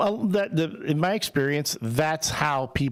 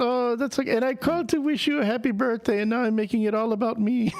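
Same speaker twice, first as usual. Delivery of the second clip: much higher, an older Puerto Rican mom's accent, badly done; 10 semitones higher than usual. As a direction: imitate his mom in a clumsy Puerto Rican accent. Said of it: "older Puerto Rican woman accent".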